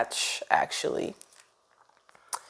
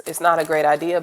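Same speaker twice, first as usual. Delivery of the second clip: ordinary talk, nothing marked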